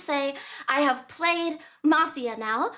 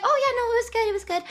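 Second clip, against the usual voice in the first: nervous brushoff; 8 semitones higher, 3 dB louder, much faster than usual. Retold or imitated. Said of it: "I was just like".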